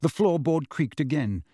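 usual speaking voice

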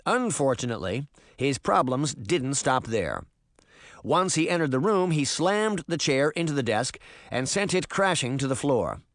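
ordinary delivery, nothing marked

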